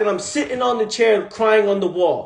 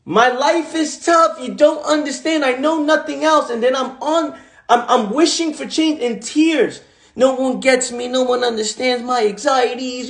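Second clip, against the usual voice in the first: put-on whiny old self; 4 semitones higher than usual. Mimicking his younger self complaining that life is tough. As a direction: moan like nobody could ever get you. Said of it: "I used to say the same thing".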